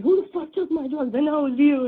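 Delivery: angry accusation. Retold or imitated. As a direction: act out his angry, loud accusation. Retells armed boyfriend accusing everyone.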